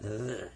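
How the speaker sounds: the with a long, low buzz; heavy-duty TH. Shown as a demonstration. Teacher asks to hear pupils say it, heavy-duty TH. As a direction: hold the TH buzz in the. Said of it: "heavy duty".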